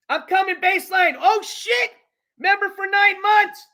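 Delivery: sudden high, excited call to a viewer; excited shout-out. Real, not acted. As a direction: react excitedly to a member's milestone. Reacting to member's milestone notification.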